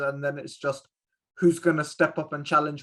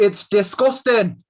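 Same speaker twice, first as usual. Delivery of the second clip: bad attempt at a Scottish accent; hammy mock Scottish accent, slow; 5 semitones higher, 9 dB louder, slower than usual. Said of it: "Was that your attempt at a Scottish accent?".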